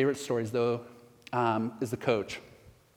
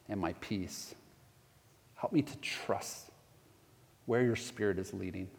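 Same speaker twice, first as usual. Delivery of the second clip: prayer growing much softer toward a near whisper; fading prayer; 3 semitones lower, 7 dB quieter than usual. Acted. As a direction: let the prayer fade down to a hush. Leads closing prayer.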